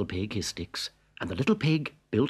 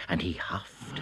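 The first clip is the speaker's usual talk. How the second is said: whispered huff; 4 semitones lower, 7 dB quieter than usual. A narrator's reading.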